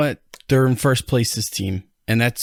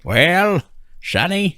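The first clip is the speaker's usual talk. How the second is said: put-on higher, creaky old grandpa calling; 7 semitones higher, much slower than usual. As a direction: play a doddering grandfather. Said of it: "That's my grandpa impression".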